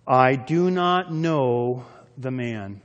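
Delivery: very slow, emphatic oath; cursing, sworn denial. Acted. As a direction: stretch each word out as you swear it. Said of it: "began to invoke a curse on himself and to swear".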